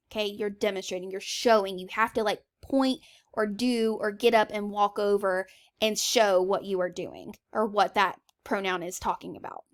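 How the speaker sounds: ordinary talk, nothing marked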